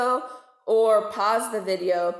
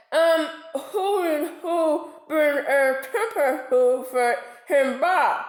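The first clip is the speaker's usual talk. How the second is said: higher, slowed gummy mumble with a half laugh; toothless mumble; 5 semitones higher than usual. A narrator's reading. Toothless shark swimming off to find a dentist, gummy mumble. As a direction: mumble through bare gums. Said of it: "Which meant".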